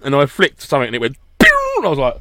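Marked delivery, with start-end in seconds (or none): 1.4-1.7 high-pitched flying-off sound effect; high pinging pew. Retold or imitated. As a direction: imitate a part pinging away. Imitates flicked part flying off.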